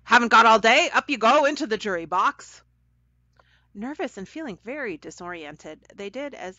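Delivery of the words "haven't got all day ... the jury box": loud, brisk, bossy call. A narrator's reading. Woman in the wig sends children to the jury box, calling out. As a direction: order them along in a raised voice. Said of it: "she called them".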